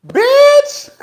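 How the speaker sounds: high, loud shriek on Bitch; dramatic high squeal. Retold or imitated. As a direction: squeal it out in mock outrage. Exclamation at end of rant.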